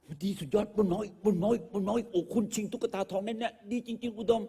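hammed-up director praising the second take; fawning director. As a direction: rave over the take like a besotted director. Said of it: "เล่นให้แม่งเทคที่สาม".

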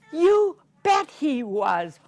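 slow, loud, gleeful; triumphant. Acted. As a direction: crow it out slowly. Triumphant storytime answer.

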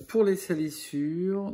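loud announcing. Real, not acted. Painter announcing the grime step to his viewers, loud and slow. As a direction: call out the next step to the viewers.

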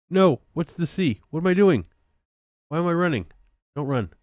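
high, loud self-scolding panic; flustered. Real, not acted. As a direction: cry out as the controls go wrong. Flustered by wrong game controls.

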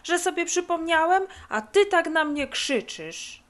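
high, crowing I-told-you-so; gloating, slightly whiny victory; triumphant reproach. Acted. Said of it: "zawołał triumfalnie leniwiec".

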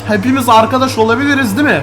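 high loud anxious plea; nervous pleading. Real, not acted. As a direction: beg them to be friends. Pleading with mummies.

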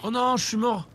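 high dismayed wail; dismayed cry. Real, not acted. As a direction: cry out at dying. Just died in game.

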